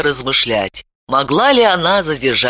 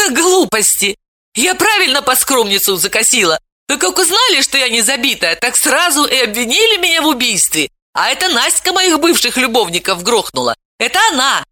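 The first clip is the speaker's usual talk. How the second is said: put-on higher, protesting girl's voice; indignant young girl; 9 semitones higher than usual. A narrator's reading. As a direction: brush off the accusation. Veta protesting.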